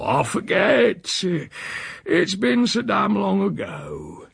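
high, slow rustic drawl; evasive old uncle. A narrator's reading. Uncle evades nephew's question, slow rustic drawl. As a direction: stretch it out, waving the question away. Voicing Uncle Silas in story.

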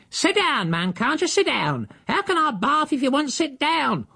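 high, exasperated scolding; a nanny losing patience; housekeeper shouting. Acted. Housekeeper to the naked Silas standing in the bath, high exasperated scold. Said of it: "the housekeeper was shouting".

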